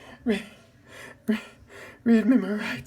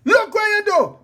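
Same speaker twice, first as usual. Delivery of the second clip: angry shout; 8 semitones higher, 6 dB louder than usual. Acted. Blaming someone in a fight.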